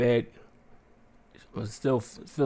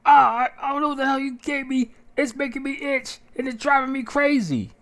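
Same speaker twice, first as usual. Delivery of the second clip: much higher, strained, desperate voice; frantic complaint; 4 dB louder than usual. Retold or imitated. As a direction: whine it out like you're itching to death. Calling the call bell, itching.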